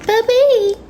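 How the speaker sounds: squeaky high mock of boyfriend's baby voice; mocking his voice. Mocking her man's voice to her viewers. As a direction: tease him with a silly high voice. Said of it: "I don't talk like that".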